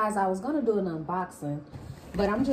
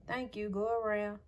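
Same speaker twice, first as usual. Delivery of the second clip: normal talking voice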